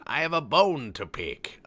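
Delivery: high, loud and slow mock outrage; dramatic, slow and loud. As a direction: boom it out like a hammed-up declaration. Mock outrage, announcing a grievance.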